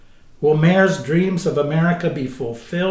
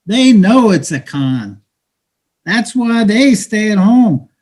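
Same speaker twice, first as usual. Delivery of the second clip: street hustler taunting a newcomer; bitter, mocking street talk to a dreamer; cynical hustler; 3 semitones higher, 10 dB louder than usual. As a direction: talk down to him like a weary hustler.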